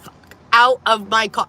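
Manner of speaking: building angry demand; getting mad. Retold or imitated. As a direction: order him out, losing her calm. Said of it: "I'm getting mad at this point".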